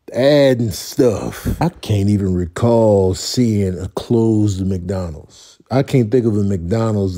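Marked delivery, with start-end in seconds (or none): none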